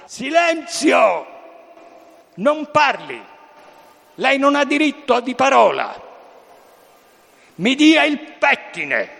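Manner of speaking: high, slow furious shouting; wild unhinged ranting. Retold or imitated. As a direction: bark orders like a tyrant. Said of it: "quando urlava De Sica era terribile".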